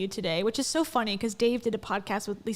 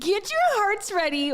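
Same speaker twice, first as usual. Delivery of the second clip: higher incredulous repeat; incredulous echo; 8 semitones higher, 4 dB louder than usual. Real, not acted. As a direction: repeat it with mocking disbelief. Repeating the clip line in disbelief.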